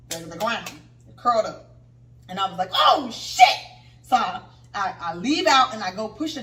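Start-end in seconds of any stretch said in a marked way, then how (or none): 2.7-3.4 high, loud retold shriek; startled yell. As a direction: blurt it out in acted shock. Retold shock at seeing the snake.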